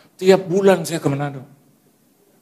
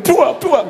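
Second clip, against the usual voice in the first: louder playful spit noise; imitated spray of spit, pitched up; mock spitting sound; 11 semitones higher, 6 dB louder than usual. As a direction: spit it out with a puff. Imitating spitting while praying.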